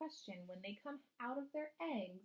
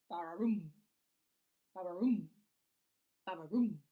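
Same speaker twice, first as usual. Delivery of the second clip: low, louder croaking ba-ra-room; frog call; 3 semitones lower, 8 dB louder than usual. Retold or imitated. Teacher imitating frog calls for the class, low croak. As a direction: croak it out like a bullfrog at night. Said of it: "you can hear".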